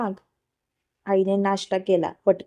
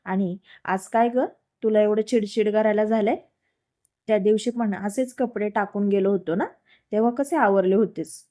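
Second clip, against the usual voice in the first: usual speaking voice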